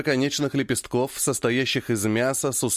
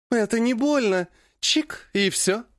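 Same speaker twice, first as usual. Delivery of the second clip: sweet coaxing; 10 semitones higher than usual. A narrator's reading. Monster coaxing him sweetly.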